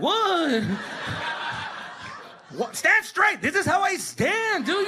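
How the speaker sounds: high, drawn-out mock protest; hammed-up defensiveness. Kid leaning back from the parent, high mock defensiveness. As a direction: protest innocence in a high whine.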